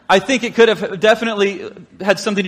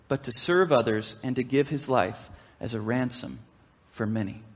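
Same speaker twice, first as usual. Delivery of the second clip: reading that fades softer to a hush; scripture reading; 5 semitones lower, 4 dB quieter than usual. Acted. Pastor reading Jesus' teaching, softening to a hush. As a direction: let Jesus' words sink low and quiet.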